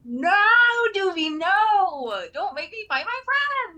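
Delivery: high, loud cry of protest; anguished shout. Acted. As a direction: plead with friends, voice high. Character cries out in protest.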